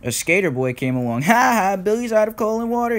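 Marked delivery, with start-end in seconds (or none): 1.2-3.0 high, teasing chant; sing-song taunt. A narrator's reading. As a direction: sing out a mocking jeer. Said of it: "he sang".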